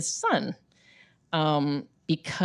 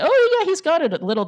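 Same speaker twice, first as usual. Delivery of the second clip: excited high agreement; 12 semitones higher, 6 dB louder than usual. Retold or imitated. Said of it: "and I say".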